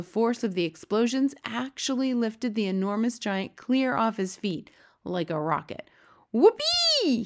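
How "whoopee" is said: very high, loud drawn-out whoop; whooping cry. A narrator's reading. Giant lifted off his feet, whooping. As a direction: let out a squealed cheer. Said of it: "he cried".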